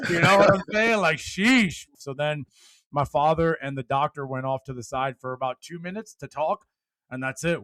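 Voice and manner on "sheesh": ordinary delivery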